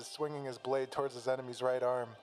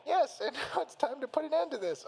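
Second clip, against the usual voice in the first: very high-pitched taunting bear voice; Teddy; 13 semitones higher, 4 dB louder than usual. A narrator's reading. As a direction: taunt the rival in a high cartoon voice. Teddy threatens to finish Yu.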